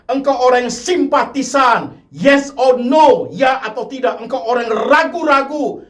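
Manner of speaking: high, loud, insistent altar call; full-force preaching. Acted. The preacher urges unbelieving listeners to accept Jesus, loud and insistent. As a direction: drive it home, urging them to decide.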